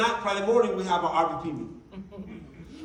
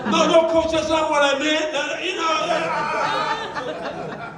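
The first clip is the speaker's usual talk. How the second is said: much higher and louder, frantic backpedal; panicked denial; 9 semitones higher, 7 dB louder, much slower than usual. Retold or imitated. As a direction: scramble to take it back. Brother protests, caught out.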